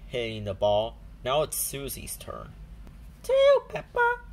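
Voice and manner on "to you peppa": put-on high, loud child shout; shouting Susie Sheep. A narrator's reading. Susie shouts to Peppa while hitting the ball. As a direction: call out as you hit the ball. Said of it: "she shouts".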